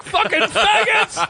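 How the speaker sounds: slow, very high laughing yell; screamed insult. Retold or imitated. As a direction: scream it while cracking up. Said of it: "I was like".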